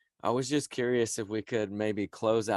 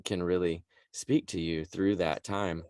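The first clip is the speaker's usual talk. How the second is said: ordinary talk, nothing marked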